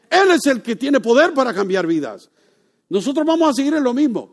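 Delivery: loud, high sermon delivery building to a peak; fiery preaching. Acted. Preacher urging the young man to come to Christ, loud and fervent. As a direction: preach it hard to the congregation.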